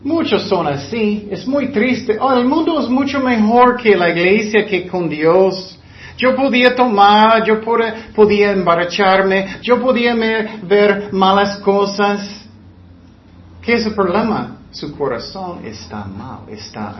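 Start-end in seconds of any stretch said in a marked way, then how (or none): 2.2-12.5 mocking worldly churchgoer. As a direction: ham up a backslider who misses drinking. Preacher voices worldly churchgoer.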